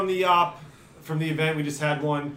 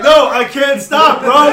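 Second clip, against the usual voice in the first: high, loud, drawn-out shout; retold yell; 8 semitones higher, 12 dB louder, slower than usual. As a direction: holler it slowly like a panicked warning on the move. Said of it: "and I'm just yelling".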